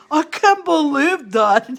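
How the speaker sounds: mock stunned other ladies; disbelieving. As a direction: stretch out the astonishment. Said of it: "And the other ladies".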